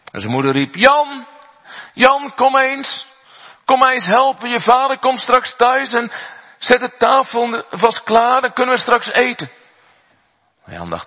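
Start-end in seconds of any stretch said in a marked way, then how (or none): 0.7-9.5 put-on high, loud mother calling up to her boy. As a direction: call Jan down from the attic. Moeder roept zoon van zolder. (moeder die hoog en luid haar zoon roept)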